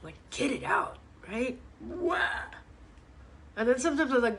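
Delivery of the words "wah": high-pitched pretend "wah"; short, high fake sob; mock crying. Shown as a demonstration. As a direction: imitate a little bawl. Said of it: "you just need to cry".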